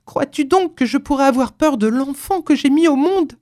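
high, loud indignant mother; impassioned outcry. A narrator's reading. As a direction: cry it out defiantly. (s'écrier avec véhémence)

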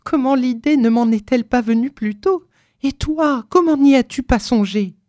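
high, urgent woman's voice; agitated. A narrator's reading. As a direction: question him in mounting agitation. Wife agitated by sudden idea. (agitée, fébrile)

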